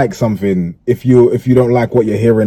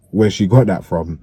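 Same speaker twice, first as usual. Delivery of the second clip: usual speaking voice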